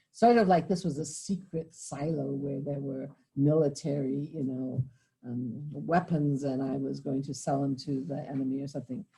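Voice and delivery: normal talking voice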